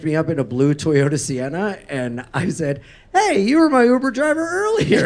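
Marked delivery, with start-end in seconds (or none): 3.1-5.1 high, loud mock greeting; acted calling out. Passenger greeting a driver they think they know, hammed-up call. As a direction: call out cheerily, hamming up the retelling.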